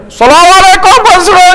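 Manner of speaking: high, loud holler of a greeting; booming, over-loud hello; too-loud village greeting. Retold or imitated. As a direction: bellow the salam as if across the jungle. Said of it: "dia cakap derah pun monyet je dengar".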